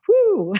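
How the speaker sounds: high, loud recoiling whew; mock disgust at beer breath; whew at the smell. Reacts to smelling his breath.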